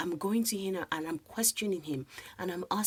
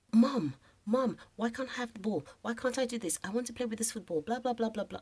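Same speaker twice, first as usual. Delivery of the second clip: mock higher-pitched whining of a son; upset child; 5 semitones higher than usual. Upset son begging mum to let him play with the football, imitated complaint. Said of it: "This child was so upset".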